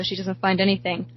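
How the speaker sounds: plain reading voice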